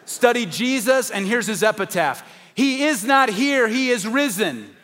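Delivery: preacher soaring over the empty tomb; triumphant preaching. Acted. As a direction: proclaim the risen Christ like a victory shout from the pulpit. Preaching climax, slow and high.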